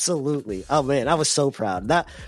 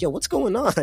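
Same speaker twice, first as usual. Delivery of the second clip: much higher, mock wife checking in; wife butting in; 11 semitones higher than usual. Dad voices his wife arriving at the scuffle, pitched up high. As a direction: imitate the wife walking up. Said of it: "Edna walks over and was like".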